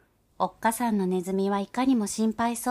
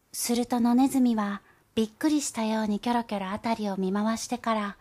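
ordinary delivery

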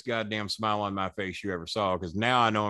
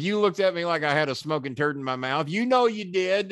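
higher, insistent promo jab, slowing at the end; mock accusation; 7 semitones higher than usual. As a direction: press the accusation on camera. Promo accusation acted out again.

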